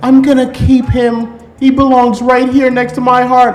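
high, drawn-out mimicked woman; mock smitten woman. Man imitating a woman claiming her new pet, mock smitten. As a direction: put on a high, doting woman's voice.

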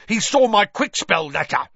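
high, loud, snarling charge; worked-up, strained caretaker rage; furious accusation. Acted. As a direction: hurl the accusation at high pitch. Snarling Filch goes on accusing.

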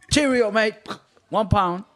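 high, chirpy mock English accent. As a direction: play a comic English doorman. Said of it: "this English accent".

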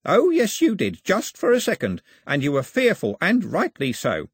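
put-on high, triumphant old man's crowing; gloating. A narrator's reading. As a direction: rub it in with glee. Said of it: "Ataba hadn't finished gloating".

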